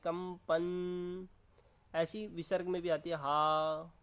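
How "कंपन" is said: कंपन stretched very slowly so each sound vibrates; drawn-out demo. Shown as a demonstration. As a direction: slowly draw out कंपन, letting the nasal hum. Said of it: "देख सकते हैं कैसे आवाज़ आ रही है". (कंपन को बहुत धीरे खींचकर बोलना)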